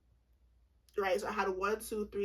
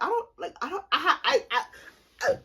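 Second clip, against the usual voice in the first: higher, louder stammering restarts; flustered fumbling; 6 semitones higher, 6 dB louder than usual. Retold or imitated. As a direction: act out fumbling for words, starting and breaking off. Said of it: "just like this, I was fumbling".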